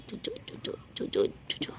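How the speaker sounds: whispered chu-chu calling sounds; soft kissing sounds to call a pet; whispered kissy calls. Real, not acted. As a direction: coax the cat over with soft kissing noises.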